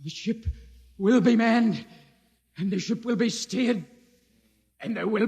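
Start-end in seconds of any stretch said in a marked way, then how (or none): none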